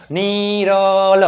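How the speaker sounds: Niral with both vowels drawn out very long; stretched vowels. Shown as a demonstration. Teacher showing the vowel inside a word, Niral drawn out slowly. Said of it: "એમાં સ્વર આવતો હોય".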